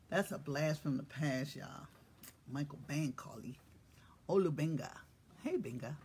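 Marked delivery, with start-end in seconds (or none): none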